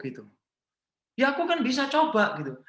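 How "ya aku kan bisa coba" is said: put-on high, insistent little kid; a child begging his dad; pleading child. Child arguing with father. (anak kecil merengek ke papanya)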